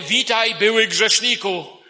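loud, high, drawn-out address; loud mock proclamation. Preacher voices what God will not say to believers, loud proclamation. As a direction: proclaim it like a public label. God's rejected greeting, voiced for contrast.

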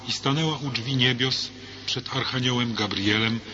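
ordinary talk

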